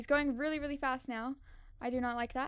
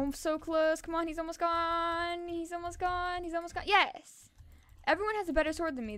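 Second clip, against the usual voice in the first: usual speaking voice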